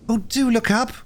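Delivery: put-on pleading little girl. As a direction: implore him as a small girl. Phyllis begs the unconscious boy.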